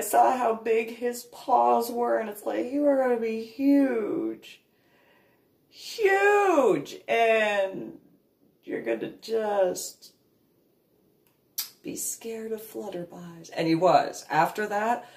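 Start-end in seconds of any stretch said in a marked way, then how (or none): 2.7-13.5 put-on high, sing-song baby talk to a dog; baby talk to puppy. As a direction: coo at the puppy, much higher. Talking to puppy.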